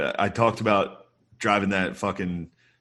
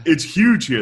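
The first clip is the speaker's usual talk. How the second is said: higher, louder retold gushing; mock excitement; 8 semitones higher, 5 dB louder than usual. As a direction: gush it loudly, pitched up. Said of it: "And I was like".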